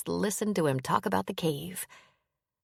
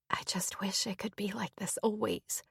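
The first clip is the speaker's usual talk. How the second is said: whispered through tears, much softer; tearful whisper; 3 semitones higher, 8 dB quieter than usual. A narrator's reading. Hannah crying against Owen on the sofa, tearful whisper. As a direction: breathe it out like she's holding back tears. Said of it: "she said with a sniff".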